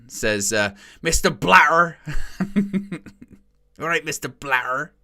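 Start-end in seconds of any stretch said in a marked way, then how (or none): 1.0-2.0 imitating a Bristolian accent, "Mister Blatter" a little higher and louder; mock an angry Bristolian manager, "Mister Blatter" pushed out. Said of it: "in his proper Bristolian accent". 3.8-4.9 lightly voiced Bristolian accent, "All right, Mister Blatter" with a rising end; a light Bristolian imitation, half laughing. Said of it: "in his proper Bristolian accent".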